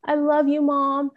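high, tender child's voice, lightly imitated; tearful son, retold. Son hugging mom, retold tearful tenderness. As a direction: imitate an upset boy comforting his mother. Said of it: "he started crying and he was hugging me".

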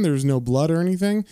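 ordinary talk, nothing marked

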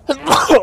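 one sudden loud sneeze; sneezing. Real, not acted. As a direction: let out a real sneeze. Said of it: "Oh, ich muss niesen".

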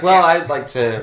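loud, slow fake Australian accent; attempted Australian accent. Co-host imitating the Australian listener, attempted Australian accent. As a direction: drag it out in a mock Aussie voice. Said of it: "I just said that in an Australian a".

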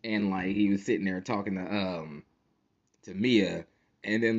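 ordinary talk

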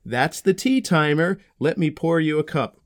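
ordinary delivery

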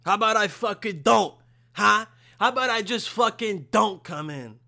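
loud, exasperated retort; irritated outburst. Real, not acted. Snapping at the boss texting about coming in, irritated outburst.

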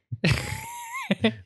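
very high, thin squeal of laughter. Real, not acted. Podcaster reacting to the recorder check, high squeal laugh. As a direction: let out a soft, high-pitched squeaky laugh.

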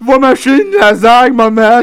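whiny spoiled son. Retold or imitated.

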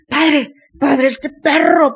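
loud, high, furious cry at the priest; angry possessed girl. Retold or imitated. Demon in the girl yelling to the priest, angry and loud. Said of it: "pero así enojada".

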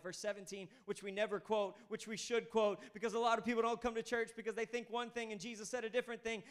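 normal talking voice

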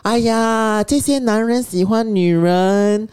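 high, slow, drawn-out grandma imitation; high sing-out voice, playfully imitating an elder; grandmother's loud commentary. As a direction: proclaim it like grandma making sure she's overheard. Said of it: "But she said it very loudly".

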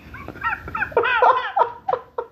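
high laugh. Real, not acted. Laughing again.

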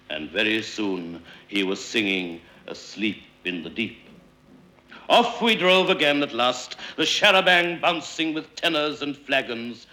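ordinary delivery

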